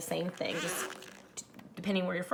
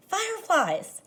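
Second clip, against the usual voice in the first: a higher, louder call to friends; 9 semitones higher, 4 dB louder, slower than usual. Acted. The boy calls his friends to come catch fireflies, excited call. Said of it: "I called to my friends".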